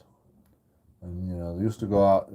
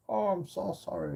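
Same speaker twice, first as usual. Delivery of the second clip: sarcastic apology; 9 semitones higher, 8 dB quieter than usual. Retold or imitated.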